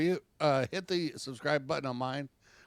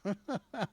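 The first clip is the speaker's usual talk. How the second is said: short laugh; 7 semitones higher, 5 dB quieter than usual. Real, not acted. Laughs at the cops.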